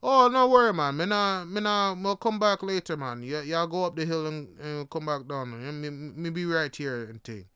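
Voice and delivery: easygoing Jamaican driver voice; Jamaican accent. Retold or imitated. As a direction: imitate a reassuring Jamaican local. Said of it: "And he said".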